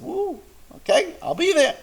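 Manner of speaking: high surprised woo, okay; startled by such an early hour; surprised yelp. Retold or imitated. Surprised moyel agreeing early time.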